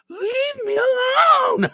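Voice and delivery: hammed-up high, loud wail; crying. Overwhelmed boy to his stepdad, hammed-up crying. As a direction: drag it out as a shrieking mock sob. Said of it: "that I just start crying".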